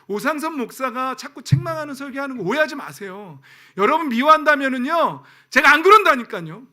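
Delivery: loud, high insistence; emphatic preaching. Acted. Pastor pleading with congregation, loud insistent preaching. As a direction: protest that he means no hatred. Pastor defends his rebuking sermons.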